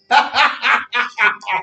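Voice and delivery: loud burst of laughter; big laugh. Real, not acted. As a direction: belly laugh at the joke. Laughing after joke.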